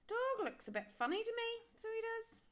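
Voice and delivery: high, loud little skeleton; a small skeleton frowning at the dog. A narrator's reading. Little skeleton looks over the dog, high and loud. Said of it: "said the little skeleton".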